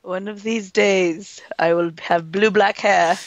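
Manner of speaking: slow, put-on British accent; drawn-out pretend British voice; mock British accent. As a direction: declare it slowly in playful British. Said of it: "With our terrible accents".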